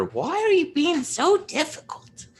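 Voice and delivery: high, grasping goblin squeak; money goblin voice. Acted. Game master grumbling at his software in a high money goblin voice. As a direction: play a greedy little goblin griping at the screen. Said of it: "that's my money goblin voice".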